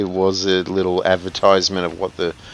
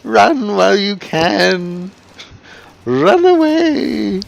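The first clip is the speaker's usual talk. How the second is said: ghostly wail; 13 semitones higher, 7 dB louder, slower than usual. Retold or imitated. Mimics ghost voice.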